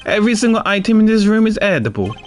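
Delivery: put-on a confectioner hamming up an Italian accent in waves; shoddy Italian accent. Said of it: "the quote-unquote Italian accent I'm a-, I'm attempting".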